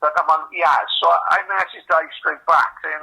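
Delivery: ordinary talk, nothing marked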